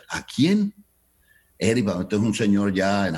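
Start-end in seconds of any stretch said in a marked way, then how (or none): 0.1-0.7 startled high Cuban "¿A quién?"; Cuban surprise. Retold or imitated. The manager who never heard of Eric, surprised. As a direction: squeak it out in disbelief, Cuban accent. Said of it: "con el acento cubano".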